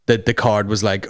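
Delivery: ordinary delivery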